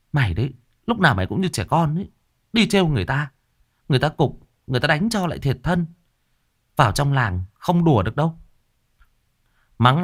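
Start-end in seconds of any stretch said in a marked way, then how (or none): 0.1-8.4 put-on scolding older sister. A narrator's reading. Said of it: "Hương mới mắng".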